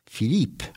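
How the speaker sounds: "Philippe" said the French way, fee-LEEP; French pronunciation. Shown as a demonstration. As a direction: say "Philippe" as two syllables, stressing the second. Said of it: "but spelled P H I L".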